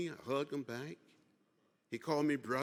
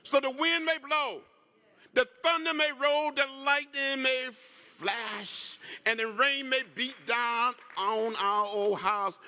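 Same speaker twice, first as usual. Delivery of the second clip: rolling preacher build, slowing at the end; preaching cadence; 7 semitones higher, 4 dB louder than usual. Real, not acted. Congressman preaching to the union hall, building slowly.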